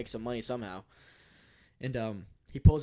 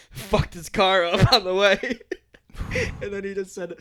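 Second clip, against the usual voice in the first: laughing; 6 semitones higher, 8 dB louder than usual. Real, not acted. Laughing at the drunk's antics.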